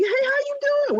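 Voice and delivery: high, bright social butterfly hello; bubbly greeting. Shown as a demonstration. Demonstrating to listeners how to work a room, high excited greeting. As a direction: greet the room like you're on fire. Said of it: "just be".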